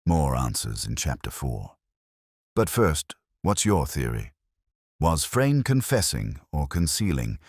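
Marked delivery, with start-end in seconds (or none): none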